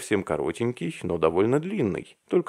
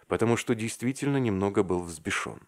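plain reading voice

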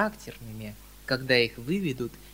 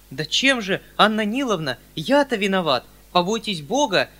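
high, louder pleading uncle; 5 semitones higher, 8 dB louder than usual. A narrator's reading. Uncle pleads with Anna Nilovna, high and imploring. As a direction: beg her to explain. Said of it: "умоляющим голосом".

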